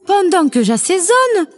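voix de poule aiguë et rapide; débite les consignes vite, haut perché; mère poule affairée. A narrator's reading. (poule de conte pressée, voix aiguë)

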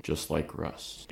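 slow, soft deadpan jab; deadpan sarcastic. Pretend. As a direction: say it dry and quiet. Sarcastic refrain.

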